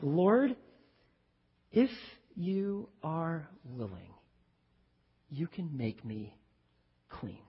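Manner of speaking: soft, slow, falling into near silence; humble plea. Acted. Leper bowing before Jesus, pleading softly and slowly. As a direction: beg quietly, barely daring to ask.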